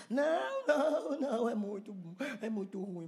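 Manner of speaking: high wail falling away; acted pleading. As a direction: mock-desperate protest. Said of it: "Aí você diria".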